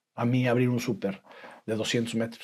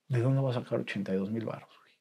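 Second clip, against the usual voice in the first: whispered, softer, desperate; 8 dB quieter, faster than usual. Retold or imitated. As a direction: whisper it to yourself in despair. Recuerda su desesperación a solas. (susurro desesperado)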